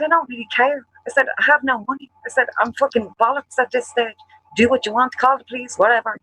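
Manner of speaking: high-pitched, fed-up outburst; exasperated retort. Retold or imitated. Telling hotel staff to call the police, in a high-pitched, exasperated outburst.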